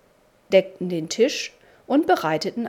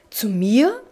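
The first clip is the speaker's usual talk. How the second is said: a startled, louder "Zu mir?"; startled cry; 3 semitones higher, 6 dB louder than usual. A narrator's reading. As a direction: gasp it out in alarm. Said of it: "rief er erschrocken".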